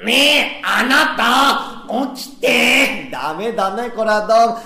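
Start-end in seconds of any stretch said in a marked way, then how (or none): 0.0-3.1 high head-voice "ねえ、あなた". Shown as a demonstration. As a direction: lift it into the head, stretching あなた. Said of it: "頭の方から声を出してさ". (頭から出す高い声で「ねえ、あなた」)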